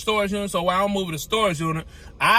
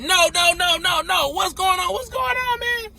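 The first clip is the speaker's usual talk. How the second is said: panicked confusion; 10 semitones higher than usual. Retold or imitated. Retold panic after injury.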